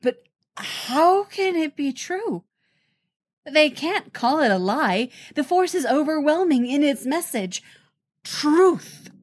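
high, disbelieving plea; incredulous. A narrator's reading. Jedi grappling with the truth, incredulous. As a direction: pitch it up in mild disbelief.

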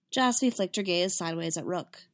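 usual speaking voice

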